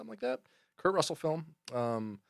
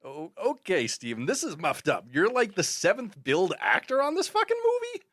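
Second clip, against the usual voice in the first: John Leguizamo impression; 6 semitones higher than usual. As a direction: scoff at the director like a cocky young actor. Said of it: "John Leguizamo kinda talks like this".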